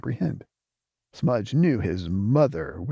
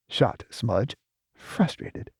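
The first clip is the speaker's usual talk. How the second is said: ordinary delivery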